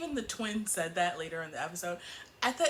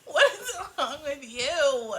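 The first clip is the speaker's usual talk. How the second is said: loud, drawn-out exasperation through laughter; half-laughing, loud disbelief; exasperated outburst; 4 semitones higher, 9 dB louder, slower than usual. Real, not acted. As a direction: demand it, stretched out and incredulous.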